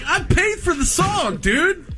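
high-pitched indignant complaint; indignant protest. Retold or imitated. Customer complaining to bartender about the skipped song, indignant.

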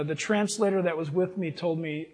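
usual speaking voice

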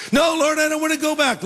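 very high, comic pleading refusal; mock whiny protest. Paul refuses the Lord's plan to send him back, mock whine.

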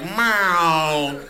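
one drawn-out meow; cat meow. Acted. Cat asking once a month, drawn-out meow. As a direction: stretch out a single cat call. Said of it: "Cats meow once a month".

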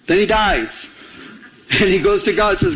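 ordinary talk, nothing marked

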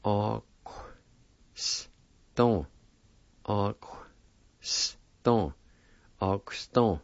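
Au-, s-, don, with the sound slid right after Au-; pronunciation demo. Shown as a demonstration. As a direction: piece Au-, s-, don together. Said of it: "pronounce a Q sound with the first A like this".

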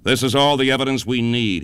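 plain reading voice

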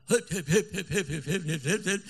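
mocking ha-ha bursts; imitated laughter. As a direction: play the whole room laughing at him. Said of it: "ואיזה צחוק, כולם מתו מצחוק".

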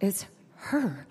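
soft, stunned murmur. Retold or imitated. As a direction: breathe it out quietly. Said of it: "she murmured".

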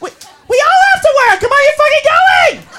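high-pitched, loud hectoring; acted exasperated yell; pushy friend shouting. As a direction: browbeat a friend into going out. Organizer friend browbeats a friend.

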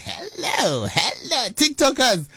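high, bright sing-song hello to the fans; excited greeting. Real, not acted. Host greeting TikTok viewers, high excited sing-song. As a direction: call out to your followers with a big lift in pitch.

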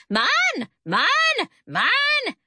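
high-pitched parrot squawk, one word at a time; hollering parrot. A narrator's reading. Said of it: "grabbing her hair and hollering".

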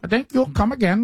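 put-on high, hesitant try at an Indian accent; bad Indian accent imitation; attempted Indian accent. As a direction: copy the Indian accent line back. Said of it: "you have to have that cue word".

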